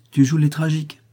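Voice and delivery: biting mockery, high and loud; mordante raillerie. A narrator's reading. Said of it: "fit Max avec la plus mordante raillerie". (raillerie mordante)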